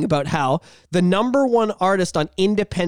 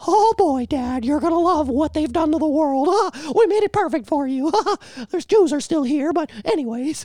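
high squeaky cartoon mouse, proud; Mickey Mouse voice; 8 semitones higher than usual. Mickey Mouse showing frozen Walt the world, squeaky.

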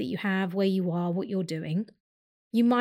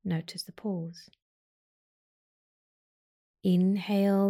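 guided meditation. Acted. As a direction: talk them through each breath gently.

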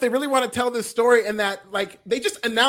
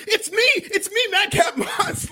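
imitating it, much higher and slower, ecstatic; exuberant mock cry; 11 semitones higher, 4 dB louder, slower than usual. Retelling Madcap Moss's big reveal, exuberant higher mock shout. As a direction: proclaim yourself like a hammed-up hero. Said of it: "just commits and exuberantly".

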